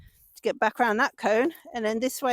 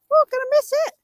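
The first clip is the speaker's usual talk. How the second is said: alarmed; 14 semitones higher, 6 dB louder, faster than usual. Real, not acted. Horse about to miss pole.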